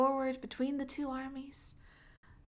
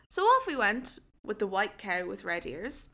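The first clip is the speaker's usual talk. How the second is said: ordinary delivery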